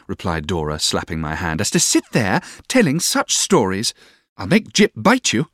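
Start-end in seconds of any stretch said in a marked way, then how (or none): 1.6-5.5 high, girlish mock threat; raised, pouty childish telling-off; childish sweetheart. A narrator's reading. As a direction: scold like a petulant little girl. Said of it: "Her childish way".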